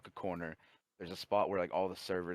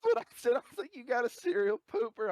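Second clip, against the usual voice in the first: excited laughing; 13 semitones higher, 6 dB louder than usual. Real, not acted. Excited agreement, laughing story.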